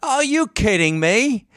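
put-on high, loud-edged drawn-out British accent; Michael Caine. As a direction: do an outraged Brit, slow and very high-pitched. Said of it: "That was my Michael Caine".

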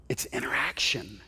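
breathy whispered punchline. Real, not acted. Pastor whispers the point to the congregation, hushed. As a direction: drop to a whisper to land it.

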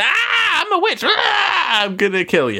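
very high screechy witch shriek; wicked witch. Retold or imitated. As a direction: burst in shrieking like a hammed-up witch. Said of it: "the witch shows up on the webcam".

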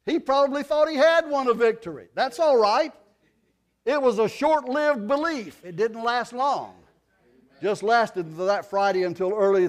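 loud, high, rising sermon cry; preaching at full force. Acted. Preacher to congregation, loud fervent preaching. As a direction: preach it out with fire.

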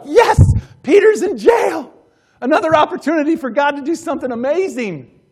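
loud, high shout of triumph; acted jubilation. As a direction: cheer it out as great news, playing the believers. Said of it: "An attitude of opportunity".

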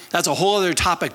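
ordinary talk, nothing marked

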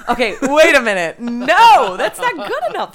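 loud, high protest through laughter; outraged rejection. Pretend. Rebutting co-host's Second Amendment answer, loud laughing outrage. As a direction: shout it down, voice jumping up. Mock outraged reply to the gun-advocate voice.

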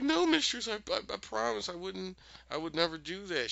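high, stammering imitation of a plea; mock pleading. Accused Miranda denying it to her mistress, mock pleading. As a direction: plead in a high voice, falling over the denial.

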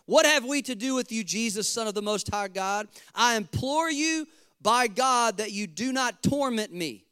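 loud, high-pitched crying out. Acted. Demon-possessed man pleads with Jesus not to torment him, crying loudly. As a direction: cry it out high and loud, like a desperate plea. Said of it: "he cried with a loud voice".

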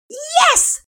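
very high, loud cheer; collective shout. Acted. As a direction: everyone yelling yes together. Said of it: "The resounding collective".

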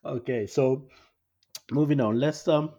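talking through real laughter; laughing it off. Real, not acted. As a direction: push through the giggles to change topic. Laughs after Maya Jama mix-up.

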